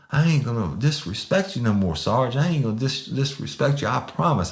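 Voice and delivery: high, whiny imitation of a cowed man; mock contrite pleading. Imitating subdued man's contrite promises.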